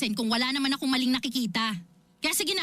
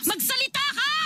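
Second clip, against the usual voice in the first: high, sharp cry of a demand; pleading order pitched high; desperate demand; 8 semitones higher than usual. Acted. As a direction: force her to answer, voice jumping up.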